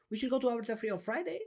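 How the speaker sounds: high, fast, rising on "Friday"; unsure uptalk. Shown as a demonstration. Coach mimics people lacking self-belief, unsure uptalk. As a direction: rush it out, ending upward on "Friday" as a question. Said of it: "they don't believe in themselves".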